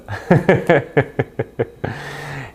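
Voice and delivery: long rire aigu et saccadé; laisse-toi aller à rire de ta blague; rire amusé. Real, not acted. Rit après sa plaisanterie. (long rire spontané)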